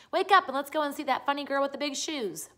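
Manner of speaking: bursting to go see her; excited cry. A narrator's reading. Annika urging Tommy to visit Pippi, excited. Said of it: "she cried, pulling him by the arm".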